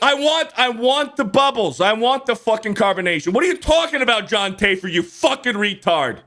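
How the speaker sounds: high, worked-up rant; real rant. Real, not acted. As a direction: vent real annoyance, pitch climbing. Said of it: "I get pissed off thinking about".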